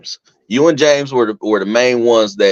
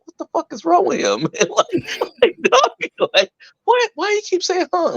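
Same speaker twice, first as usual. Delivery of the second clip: laughing high disbelief. Retold or imitated. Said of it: "And I'm like".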